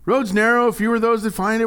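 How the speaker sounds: high, loud mock alarm; alarmed protest. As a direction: protest as if startled by the verse. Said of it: "I read that and I was like".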